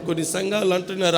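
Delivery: usual speaking voice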